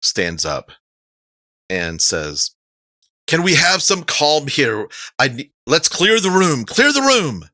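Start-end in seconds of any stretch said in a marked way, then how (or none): 3.3-7.5 high raised call for calm; rising, louder call to clear the room; calling for order. Acted. Speaker calls for calm.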